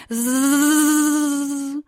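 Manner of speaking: long swelling insect hum; mosquito buzz. Acted. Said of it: "saust es wieder durch die Luft".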